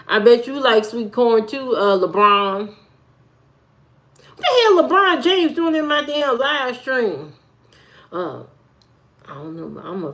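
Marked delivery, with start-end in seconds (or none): 2.7-7.3 high, slow startled outburst; surprised exclaim. Real, not acted. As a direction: react like someone just barged in. Surprised at LeBron in stream.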